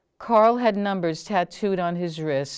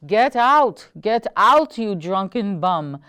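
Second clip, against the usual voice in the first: furious bellow, much louder and higher; 3 semitones higher, 7 dB louder, slower than usual. Acted. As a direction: roar at the drunk husband to get out. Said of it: "Martha's bellowing voice".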